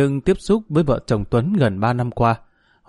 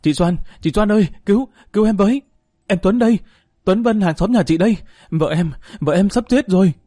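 panicked shouting; 7 semitones higher than usual. Acted. Man begging the neighbour's wife to save his wife, panicked shouting. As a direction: beg frantically through the rain. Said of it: "Tuấn hét lên".

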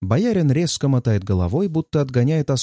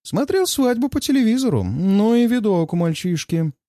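lazy, careless smirk; 8 semitones higher than usual. Acted. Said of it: "небрежно усмехается и лениво произносит".

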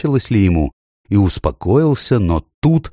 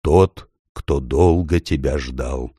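extremely deep bass voice; 4 semitones lower, slower than usual. Acted. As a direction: speak low and slow like a vast hidden being. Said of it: "негромкий, но очень глубокий голос".

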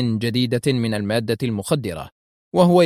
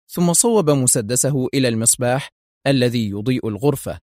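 ordinary delivery

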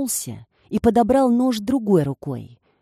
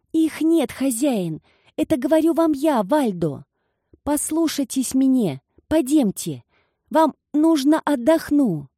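reassuring servant; 7 semitones higher than usual. Acted. Servant soothing frightened master.

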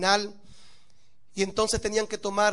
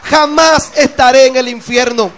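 loud, soaring sermon voice; fiery preaching; 3 semitones higher, 16 dB louder than usual. Acted. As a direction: preach it out to the whole church. Preacher at full force.

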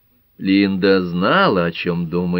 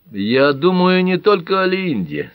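usual speaking voice

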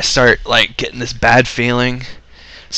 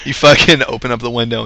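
ordinary talk, nothing marked

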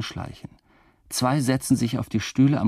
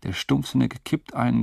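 ordinary delivery

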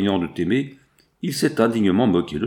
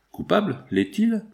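much higher, doubting cry; incredulous question; 10 semitones higher than usual. Acted. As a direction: throw the word back in disbelief, voice leaping up. Solange doubts father's accusation.

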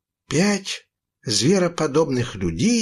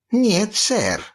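purring refusal; 8 semitones higher than usual. A narrator's reading. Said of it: "промурлыкал кошачий зуб".

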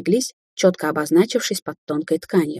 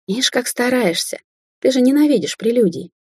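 sneering fake sweet; 7 semitones higher than usual. A narrator's reading. Ruby mocking, voice shifted.

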